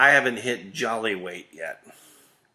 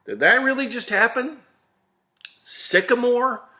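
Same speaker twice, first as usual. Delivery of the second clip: incredulous disbelief; 12 semitones higher, 5 dB louder than usual. Retold or imitated. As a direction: act like you can't believe what just happened. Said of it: "and I'm like".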